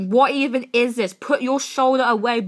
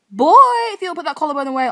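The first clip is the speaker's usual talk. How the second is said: fast, loud, higher fangirl shout; excited shout; 4 semitones higher, 8 dB louder, much faster than usual. Real, not acted. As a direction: yell it at the screen. Fangirl reacting to photos.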